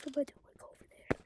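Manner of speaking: fast, hushed whisper. Real, not acted. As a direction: whisper a quick warning. Warning teammates quietly.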